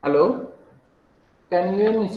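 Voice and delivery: ordinary talk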